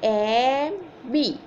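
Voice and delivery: put-on slow, drawn-out spelling for children to repeat; slow classroom spelling drill; slowly, letter by letter. Shown as a demonstration. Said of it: "મારી સાથે સાથે ધીમે ધીમે તમે બોલો".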